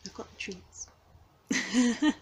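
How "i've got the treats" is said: coaxing whisper. Real, not acted. Coaxing hiding cat softly.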